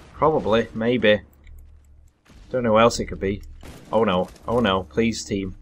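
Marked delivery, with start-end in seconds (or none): none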